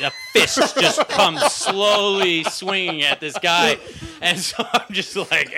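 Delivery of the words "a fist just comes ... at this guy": drawn out in slow motion, higher and laughing. Retold or imitated. Telling the coffee shop fight, in slow motion. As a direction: stretch it like a slow-motion replay. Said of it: "start a slow motion fight".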